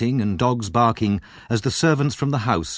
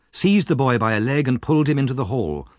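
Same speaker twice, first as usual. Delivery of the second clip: plain reading voice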